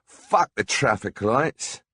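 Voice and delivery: high, loud frantic yelling; retold scream. Jilted lover screaming through London streets, loud frantic yell. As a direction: yell it at the traffic like a jilted madman.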